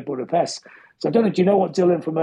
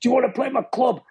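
higher-pitched mock Scottish accent; Alan McGee; 8 semitones higher than usual. Club promoter offering the band a gig, mock Scottish accent. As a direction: imitate a Scottish caller, voice lifted. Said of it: "Scottish accent".

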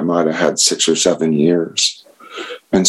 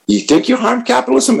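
much higher, lightly voiced teasing sponsor; mock incredulous sponsor. Sponsor questions his list, high mock disbelief. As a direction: tease him gently, pitched way up.

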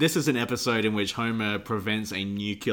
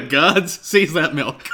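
loud, higher hammed-up command; mock royal order; 7 semitones higher, 8 dB louder, slower than usual. Joining the bit with a loud mock royal command. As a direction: call the guards like a pompous king.

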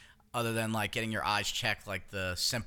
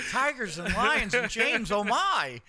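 higher and slower, a playful Oz parody; mock sing-song; 10 semitones higher, 5 dB louder, slower than usual. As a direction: recite it like Dorothy, teasing. Wizard of Oz parody.

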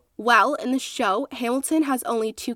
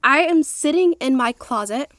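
ordinary talk

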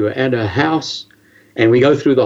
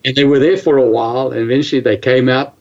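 normal talking voice

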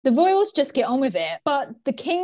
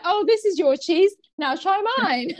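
imitating the king; 7 semitones higher than usual. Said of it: "he's like".

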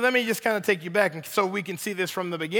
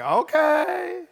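Adam delighted by Eve; 5 semitones higher, 3 dB louder, much slower than usual. Retold or imitated. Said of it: "And Adam was like".

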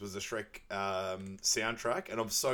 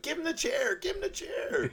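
much higher, shrill-free mock crowd chant; crowd woman. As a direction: egg him on like a rowdy fan.

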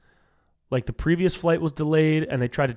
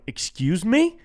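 incredulous; 4 semitones higher, 5 dB louder than usual. Retold or imitated. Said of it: "I was like".

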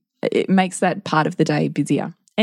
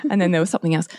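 ordinary talk, nothing marked

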